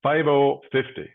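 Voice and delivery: steady, rhythmic number calling; bingo caller. Acted. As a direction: call each number out, then pause.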